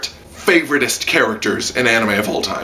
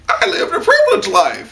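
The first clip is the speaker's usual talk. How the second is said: put-on higher, laughing naive rich boy; mock Sion; 8 semitones higher than usual. Reviewer mimicking naive Sion, lightly laughing. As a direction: say it like a sheltered kid, laughing. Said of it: "He's like".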